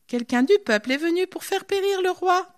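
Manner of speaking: loud, high accusation; reproche crié. Acted. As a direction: call out the charge across the valley. David shouts reproach at Abner. (reproche lancé fort et aigu)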